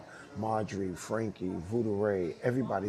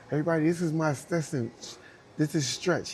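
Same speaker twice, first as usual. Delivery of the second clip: imitating a group introduction, higher pitched; announcing to the room; 6 semitones higher, 3 dB louder than usual. Rosie introducing her assistant to everybody, announcing.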